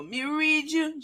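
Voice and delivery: high-pitched fake Jamaican psychic; Miss Cleo. Host quotes a TV psychic, mock Jamaican accent. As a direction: mimic a phony island fortune teller. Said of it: "her accent was terrible".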